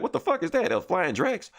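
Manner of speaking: gawping at a flying brute; shocked onlookers. Retold or imitated. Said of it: "they're like".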